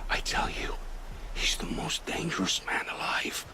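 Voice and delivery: hushed, breathy warning; fearful whisper. Acted. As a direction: whisper it as if the walls could hear. Frightened informant confides secret.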